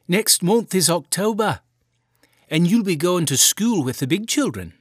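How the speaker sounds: a hint of a fond Irish mother; mother reassuring her son about the shirt; cheerful mother. A narrator's reading. Mother voiced in story.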